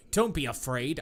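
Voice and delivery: ordinary talk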